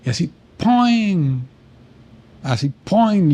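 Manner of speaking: high, slow, drawn-out ¡poing!; spring-like boing for a sudden realization; mock "poing" sound effect. (un ¡poing! juguetón, agudo y alargado)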